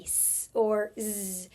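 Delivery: S as a held "sss", Z as a buzzed "zzz"; two letter sounds. Shown as a demonstration. Teacher showing two sounds of one letter, sss or zzz. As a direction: sound out S, then Z, not the letter names. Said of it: "it can say".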